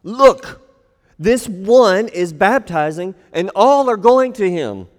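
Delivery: loud, high, slowed resentful "this one is baptizing"; angry complaint. Acted. Disciples complaining angrily to John about Jesus. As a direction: spit out "this one" with scorn. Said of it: "It's angry".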